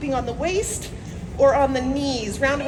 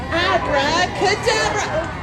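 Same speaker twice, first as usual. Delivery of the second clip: slow, drawn-out magic incantation; kids party magician; 4 semitones higher, slower than usual. Acted. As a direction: stretch the spell out grandly. Magician casts spell slowly.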